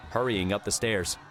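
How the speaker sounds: normal talking voice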